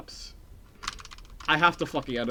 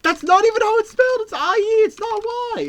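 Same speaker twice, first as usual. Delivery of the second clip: much higher, louder nagging correction; squeaky indignant correction; high mock voice; 7 dB louder than usual. Streamer correcting chat's spelling in high mock voice.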